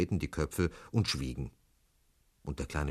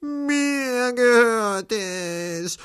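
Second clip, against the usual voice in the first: very high, drawn-out wail; loud, slow, acted crying; sobbing little girl; 8 dB louder, much slower than usual. A narrator's reading. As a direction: stretch it into a child's tearful wail. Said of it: "schluchzte ein kleines Mädchen".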